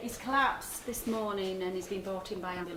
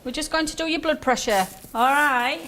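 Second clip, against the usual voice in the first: loud, higher voice to a patient; raised voice; 5 semitones higher, 8 dB louder than usual. Acted. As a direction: speak up clearly to the patient. Nurse speaks up to patient.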